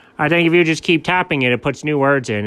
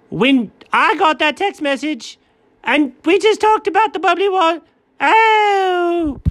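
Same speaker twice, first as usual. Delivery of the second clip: shocked disbelief; 11 semitones higher than usual. Real, not acted. Shocked the website knows.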